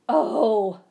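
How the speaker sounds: a loud high howl of alarm; loud startled howl. A narrator's reading. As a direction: yelp it out in fright. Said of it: "howled Mister Winky".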